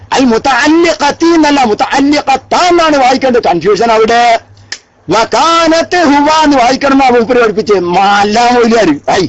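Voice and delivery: ordinary talk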